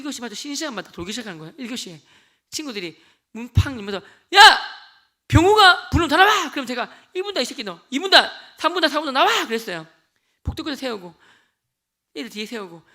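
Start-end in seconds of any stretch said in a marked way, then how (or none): 4.3-6.5 loud, slow, higher bellow; thug shouting. Retold or imitated. Thugs shouting into classroom. 7.1-9.5 mock threat. Threatening classmates.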